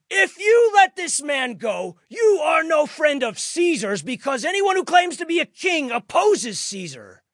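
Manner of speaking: very high, loud, sustained shout; leaders shouting. A narrator's reading. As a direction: yell the warning over a crowd.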